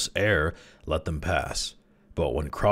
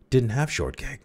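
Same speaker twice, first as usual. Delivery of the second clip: higher-pitched, mildly husky denial; a touch of huskiness, defensive; 8 semitones higher than usual. A narrator's reading. Crawley denies having shortcake, mildly husky. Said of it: "he said huskily".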